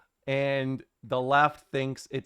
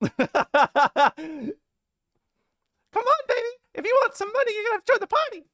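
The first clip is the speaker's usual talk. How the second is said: much higher, louder hammed-up manager voice with laughs; Jimmy Hart parody; 5 dB louder than usual. Streamer jokes to chat as a communist Jimmy Hart, laughing and hammy. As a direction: ham up a wrestling manager hawking communism. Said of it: "Stalin, uh, Leninist Jimmy Hart".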